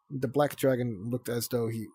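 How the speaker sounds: ordinary delivery, nothing marked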